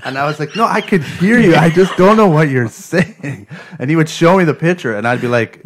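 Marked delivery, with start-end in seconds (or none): 0.5-3.5 exasperated protest. Retold or imitated. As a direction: explain it with frustrated emphasis. Protesting to the tester.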